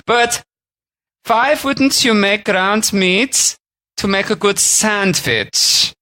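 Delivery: put-on high, slow German-accented butcher; high, slow old German butcher accent. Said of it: "he would have spoken a little bit like this".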